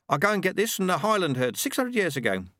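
a proud tradesman talking shop; Ronnie the milkman. Acted. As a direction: Ronnie the milkman, pleased with his product. Ronnie boasting about butter.

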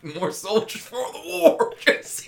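high, loud, cracking-up rant; mock war cry dissolving into laughs; laughing rant. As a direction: push through hysterical laughter. Absurd joke rant laughing.

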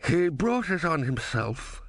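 slow, unruffled ruler; a lightly voiced calm tyrant. A narrator's reading. The tyrant answers Vorbis.

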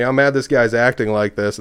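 ordinary talk, nothing marked

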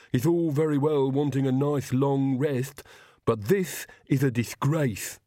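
slow, put-on henchman voice; disgruntled Igor. Igor reproaches his resting old master, grumbling. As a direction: grumble with drawn-out words like an old retainer.

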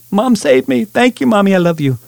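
high, delighted gush; gushing thanks. Real, not acted. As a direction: beam with thanks to mom. Thanks his mom on air.

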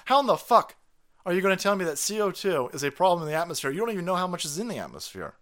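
loud, fast, exasperated challenge; retold angry challenge. Retelling the man confronting climate panelists, loud and fast. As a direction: hammer the question home, fed up. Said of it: "He goes".

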